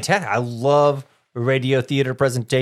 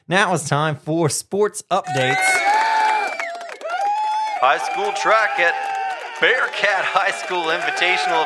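announcer; 7 semitones higher than usual. Acted. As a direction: announce the segment with a lift. Show segment announcement.

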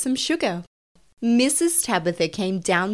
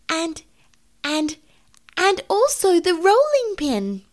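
high, indignant kitten voice; huffy kitten; 12 semitones higher, 4 dB louder than usual. Acted. Mittens reporting the butter thief to mother, huffy. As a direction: tell on the thief in a high-pitched huff. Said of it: "said Mittens in a huff".

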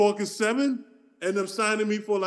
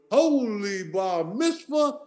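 slow punchline; much slower than usual. Retold or imitated. Retold on-air punchline.

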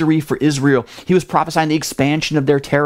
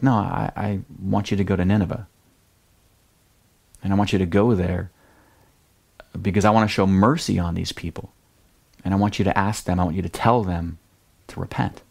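calm, patient God; 6 semitones lower, 4 dB quieter, faster than usual. Retold or imitated. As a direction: lower and softer, steady and gentle. God patiently repeats his command.

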